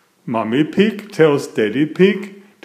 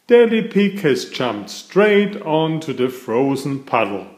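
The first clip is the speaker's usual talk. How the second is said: ordinary delivery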